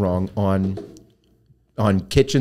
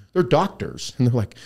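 ordinary talk, nothing marked

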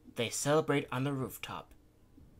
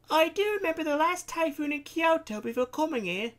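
put-on much higher, light young girl; high girl's voice; 6 dB louder than usual. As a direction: lift it up into a small bright girl's voice. Miyu's voice.

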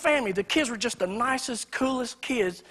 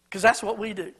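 ordinary delivery

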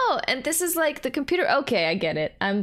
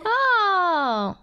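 surprised; 8 semitones higher, 4 dB louder than usual. Real, not acted. Spotting Noelle on screen.

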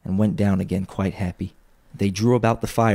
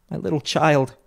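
higher, anguished father's cry; breaking down; 8 semitones higher than usual. A narrator's reading. Bob breaks down over his child, crying out. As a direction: let grief lift the voice sharply. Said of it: "He broke down all at once".

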